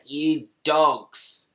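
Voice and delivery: drawn-out mock yell of abuse; slow, scornful holler, partly held back; retold yell. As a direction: yell the insult slowly, stretching each word. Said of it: "I just yelled out".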